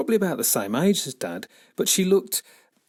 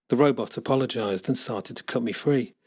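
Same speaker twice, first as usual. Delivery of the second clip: ordinary delivery, nothing marked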